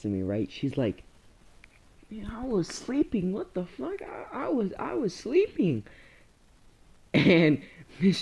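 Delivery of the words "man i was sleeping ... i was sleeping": high, soft put-on classmate voice; woken-up sleeper. Sleeping classmate jolted awake by yelling, high soft complaint. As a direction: complain quietly like someone just woken up.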